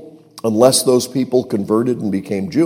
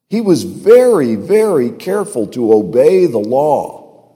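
normal talking voice

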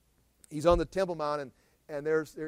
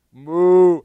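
loud mock cow moo; 20 dB louder than usual. Preacher imitating the cow being slaughtered, loud moo. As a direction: bellow it like a cow.

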